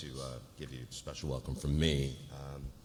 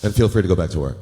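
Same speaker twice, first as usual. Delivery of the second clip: much louder, blunt send-off; loud dismissal; 3 semitones higher, 16 dB louder, faster than usual. Acted. Lewis dismissing the new associate loudly. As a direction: bark him back to work.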